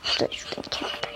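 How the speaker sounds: hushed whisper while hiding. Real, not acted. Hiding from the neighbor, whispering to viewers. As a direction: breathe it so the chaser can't hear.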